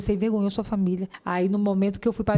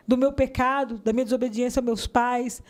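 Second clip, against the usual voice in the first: ordinary talk, nothing marked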